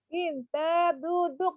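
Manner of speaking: put-on high, bright praise to a child; teacher praising a small pupil. Shown as a demonstration. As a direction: coax the child to sit kindly.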